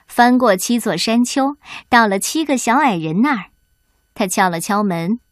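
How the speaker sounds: usual speaking voice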